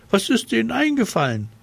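hoch, schnell und empört; entrüstet hochfahren. Acted. Lotto empört sich über Wähler. (schnelle, hohe Empörung)

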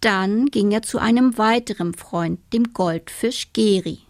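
usual speaking voice

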